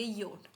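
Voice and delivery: German J named योट, not "jay"; German letter J. Shown as a demonstration. Teacher answering on German J, योट as "yot". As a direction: say योट as "yot", y sound first. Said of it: "जे को कैसे pronounce करेंगे?".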